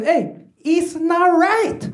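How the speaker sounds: high, drawn-out imitation of dad; accented dad handing down the moral; dad's verdict. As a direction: deliver dad's punchline slowly and high. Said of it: "And he goes".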